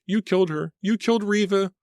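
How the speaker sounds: high panicked shriek repeated. Acted. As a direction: shriek it in panic over her cousin. Pam accuses the killer.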